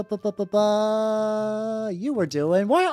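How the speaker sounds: normal talking voice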